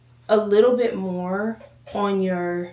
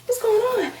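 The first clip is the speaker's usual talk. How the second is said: breathless surprise; 13 semitones higher, 3 dB louder than usual. Real, not acted. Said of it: "I'm so out of breath".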